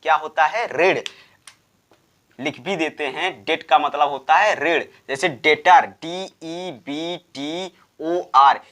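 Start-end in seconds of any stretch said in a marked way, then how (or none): none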